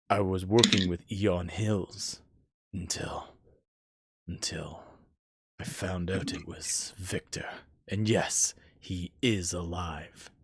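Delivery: halting, soft, half-whispered reveal; hushed confession. Acted. Dead witness reveals Victor is alive, hushed. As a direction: let it out low and haltingly, near a whisper. Severed head reveals the secret.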